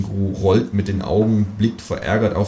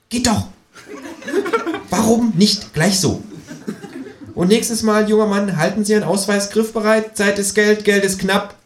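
high, pushy kangaroo voice; a cheeky high voice for the Kangaroo; 13 semitones higher than usual. A narrator's reading. Känguru nags the narrator to clean the bath, high and pushy.

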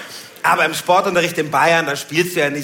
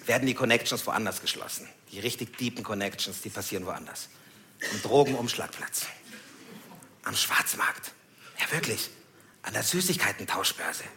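much softer, conspiratorial lowering; hushed; 6 semitones lower, 11 dB quieter than usual. Real, not acted. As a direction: let them in on a secret. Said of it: "conspiratorial lowered voice". (leise, verschwörerisch)

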